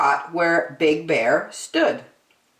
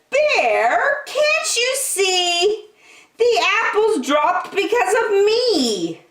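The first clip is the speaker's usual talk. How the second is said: much higher, airy wind voice. Acted. The wind teasing Big Bear, much higher.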